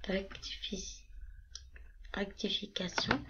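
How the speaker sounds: soft whispered self-correction. Real, not acted. Correcting herself in a whisper to viewers.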